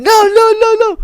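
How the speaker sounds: high squeaky protesting no; imitating dad and auntie. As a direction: mimic relatives refusing in a high voice. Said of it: "my dad and my auntie said".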